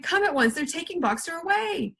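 high-pitched cry of alarm; urgent call to come, pitched well up; shouted. A narrator's reading. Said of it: "he shouted".

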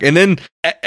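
ordinary talk

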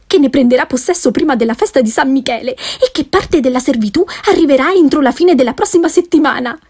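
excited wife bursting with gossip; eager gossiping wife. Acted. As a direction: rattle off the news, speeding at the end.

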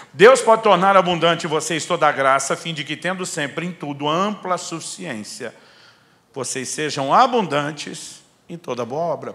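loud, slow, lifted scripture proclamation; preached scripture reading. Acted. Pastor proclaiming the text to the congregation, fervent. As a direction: proclaim the verse to the whole church.

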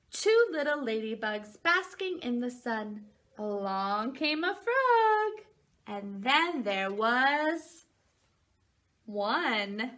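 slow, sing-song picture book reading; reading to a toddler. Acted. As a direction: read it like bedtime for a little one. Reads picture book to children.

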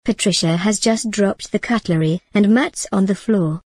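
plain reading voice

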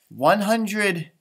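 plain reading voice

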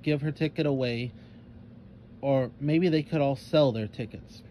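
ordinary delivery

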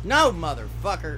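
loud. Real, not acted. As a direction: yell it at the game, fed up. Gamer cursing at the game.

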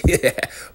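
squeaky, breathy giggle; high giggle. Real, not acted. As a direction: giggle at the naughty joke. Laughing at the nude photos.

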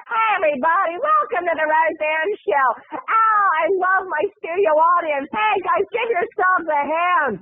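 high, gushing sweet host voice; fake-nice Roseanne. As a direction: turn on the sugary TV charm. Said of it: "the bitch got nice".

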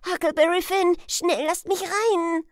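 put-on high, loud, frantic boy's plea; urgent announcement. Huck at the door to the old man, urgent high shout. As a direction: shout your name and beg entry. Huck names himself, begs entry.

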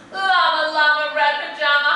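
sing-song picture-book rhythm; animated read-aloud to a class; reading to children. A narrator's reading. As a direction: lilt it like a bedtime story for little ones. Reads rhyming picture book aloud.

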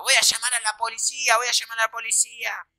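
high, sustained mock threat; imitated panicky rival. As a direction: mimic someone yelping threats from indoors. Imitates rival threatening behind door.

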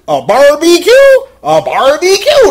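a barbecue, really?; incredulous. Retold or imitated. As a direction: repeat it like you can't believe it. Incredulous repeat of suggestion.

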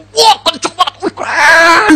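high excited whoops; thrilled rider. Acted. Rider thrilled by a galloping horse, high excited shouting. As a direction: whoop with hammed-up delight at the speed.

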